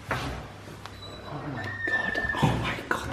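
breath-only, very soft amazement; hushed, real starstruck wonder; awed whisper. Real, not acted. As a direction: whisper it in slow disbelief. Hushed awe in the hotel corridor.

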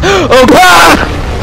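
sharp high gasp then cry; frightened gasp. Real, not acted. Gamer seeing the monster open its mouth, frightened gasp.

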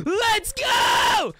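loud, high-pitched victory yell; hype shout. Pretend. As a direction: cheer it at full volume like a big win. Hype cheer for winning it all back.

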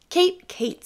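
high, loud dismayed calling of her name; exasperated cry. A narrator's reading. Husband scolding his wife over the ruined dinner, high and loud.

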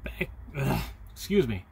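ordinary talk, nothing marked

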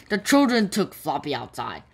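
usual speaking voice

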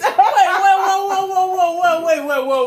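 rapid-fire high repetitions with laughter; excited, tumbling protest; frantic stalling. Real, not acted. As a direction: urgently hold everyone off. Stalling to get answer in.

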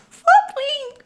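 high, loud squealed title; thrilled book-title squeal; excited squeal. Real, not acted. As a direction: shriek the name with delight.

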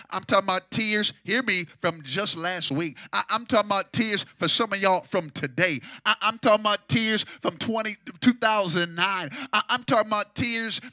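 loud, sung preaching cadence over the organ; loud tuneful preacher's whoop; preaching at full force. Acted. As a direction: whoop it out to the congregation. Preacher whooping about tears.

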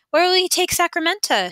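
innocent. Acted. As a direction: ask sweetly as if nothing's planned. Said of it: "she asked innocently enough".

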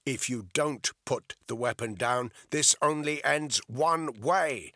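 loud warning, slowing at the end; shouting. A narrator's reading. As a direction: shout a hard ultimatum. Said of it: "he shouted".

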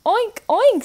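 teacher voicing the pig for kids; pig oinks. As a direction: squeal it like a piglet. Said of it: "says the pig".

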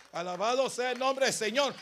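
loud, high preacher's praise; full-force preaching. Real, not acted. Preacher praising the Lord before the church, full-force preaching. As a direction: build loudly toward the quote.

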